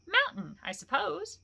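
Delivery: lightly voiced hobbit answering; brisk hobbit, a little pleased. Bilbo answers Gollum's riddle, lightly voiced hobbit.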